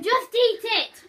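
high, loud, slow impatient shout. Real, not acted. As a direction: yell it at them. Urging friend impatiently.

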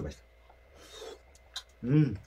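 talking while chewing food; muffled by a mouthful; mouth full, chewing. Real, not acted. Seen 4: Man bites food piece at 0.4, chews with full cheeks throughout.

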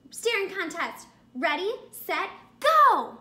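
rising countdown, loud high go; countdown shout. Acted. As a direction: build up and shout the last word. Countdown to staring contest.

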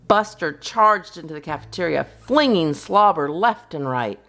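ordinary delivery

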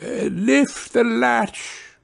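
high, slow granny voice; thin high call of an invalid; frail grandmother. Acted. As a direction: cry out feebly from bed. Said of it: "cried out the grandmother".

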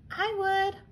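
high, small snail voice; little snail. A narrator's reading. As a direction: pipe up like a tiny snail. Snail answers crab.

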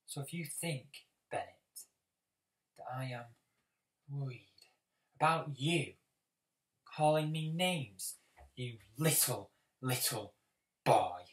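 halting put-down, broken by deliberate pauses; contemptuous confrontation. Acted. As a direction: spell it out piece by piece to someone beneath you. Confronting Bennett over name-calling.

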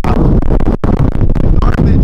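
startled shout. Real, not acted. Garbage blown away in wind.

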